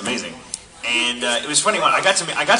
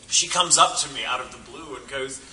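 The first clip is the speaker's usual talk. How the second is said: ordinary delivery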